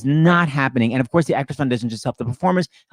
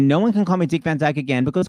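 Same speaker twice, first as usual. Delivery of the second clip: ordinary talk, nothing marked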